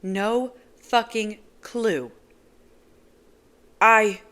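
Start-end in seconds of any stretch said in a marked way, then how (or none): 3.8-4.2 loud, stumbling outburst; floundering. Acted. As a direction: lose the words mid-yell. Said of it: "I flounder".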